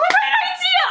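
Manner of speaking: high-pitched squeal of agreement, half laughing; mock-excited. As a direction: jump on the idea with over-the-top glee. Retold eager answer to joke.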